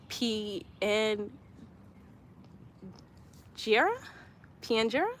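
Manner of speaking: sounding out "P N Jera" haltingly, with long pauses; unsure pronunciation. Real, not acted. Streamer attempting a commenter's word "Pianjera", unsure pronunciation. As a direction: try "Pianjera" piece by piece, questioning it. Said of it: "if I don't really pronounce this right".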